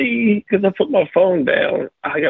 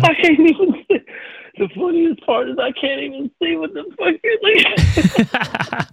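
high, squeaky laugh-choked voice; helpless laughter; 11 semitones higher than usual. Real, not acted. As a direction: squeak the words out while cracking up.

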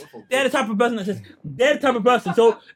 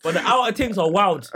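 normal talking voice

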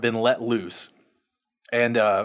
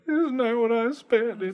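high, whiny mock; 10 semitones higher than usual.

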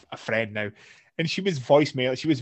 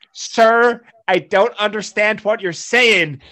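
higher, louder, slow and patient mock customer-service voice; much higher, slowed mock call-centre politeness; imitating an American agent; 8 semitones higher, 9 dB louder, slower than usual. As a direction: play the calm American support agent, drawn out. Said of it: "on the phone to an American".

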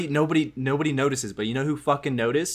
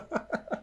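sudden high snort of laughter; 11 dB quieter than usual. Real, not acted. Man snorts laughing at friend's sentence. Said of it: "I snorted".

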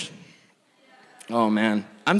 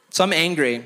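usual speaking voice

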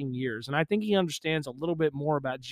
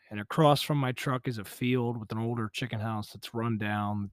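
ordinary talk

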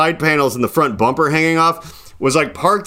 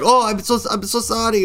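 higher-pitched mock apologetic lady; mock apology; 7 semitones higher than usual. Lady apologises to man she nearly hit, mock apologetic. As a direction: imitate a flustered apology.

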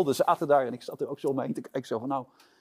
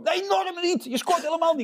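imitated yell, much higher and louder; mock shouting; 5 dB louder than usual. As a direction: shout him down in a shrieking retort. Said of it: "zo verschrikkelijk hard tegen mij te schreeuwen".

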